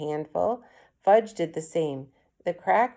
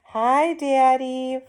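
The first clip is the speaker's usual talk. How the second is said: put-on slow, higher and louder little-kid hello; toddler voice; 8 semitones higher, 6 dB louder, much slower than usual. A narrator's reading. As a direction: sing it out like a small child. Toddler greeting his father.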